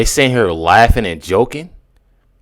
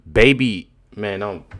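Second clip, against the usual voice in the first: usual speaking voice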